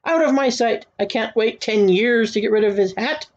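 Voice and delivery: ordinary delivery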